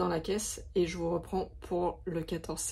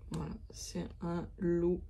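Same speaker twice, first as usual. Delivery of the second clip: whispered; 2 semitones lower, 5 dB quieter than usual. Real, not acted. Whispered aside while showing canvas.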